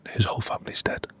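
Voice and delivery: hushed whisper, barely voiced. Nurse whispering bad news to the doctor. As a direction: whisper it so the child can't hear. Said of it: "the nurse whispered in my ear".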